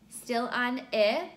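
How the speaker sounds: ordinary delivery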